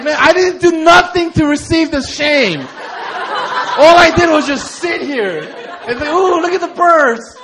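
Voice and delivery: loud high laughing protest; indignant kid. Retold or imitated. Young [name] protesting his shame, loud and high through laughs. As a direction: complain like a wronged kid. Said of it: "I was like".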